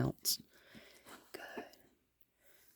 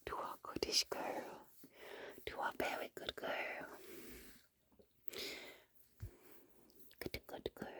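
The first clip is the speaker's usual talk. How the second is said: whispered praise; 19 dB quieter than usual. Real, not acted. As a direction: murmur it in a whisper.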